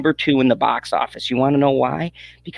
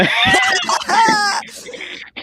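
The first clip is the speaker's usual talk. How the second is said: high shrieking laugh that breaks out. Real, not acted. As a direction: explode into laughter. Host cracks up at the outburst.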